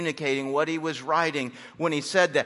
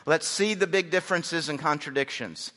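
ordinary delivery

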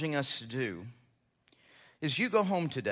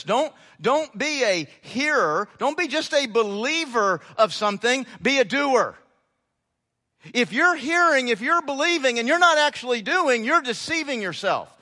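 full-force preaching; 7 semitones higher, 6 dB louder than usual. Acted. Pastor pressing scripture on the congregation, fervent preaching. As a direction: hammer the command home.

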